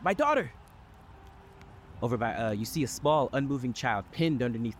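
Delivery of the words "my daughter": calling out. Acted. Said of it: "he calls".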